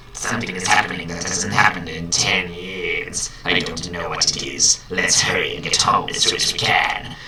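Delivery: lightly voiced sinister emperor; a hint of a scheming old villain; Palpatine voice. Doctor Roy telling his bird to hurry in a light Palpatine voice. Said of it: "I'm gonna do Palpatine".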